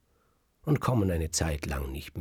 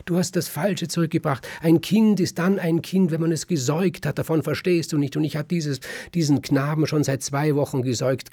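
much higher and louder, shouting throughout; a wife screaming at her husband to fetch her child; furious tirade; 8 semitones higher, 7 dB louder than usual. Acted. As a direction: tear into him and demand the baby back. Wife berates husband, demands child back.

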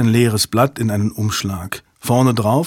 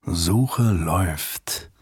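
slow, quiet murmur; 5 semitones lower, 5 dB quieter, much slower than usual. A narrator's reading. Repeated, slower and softer.

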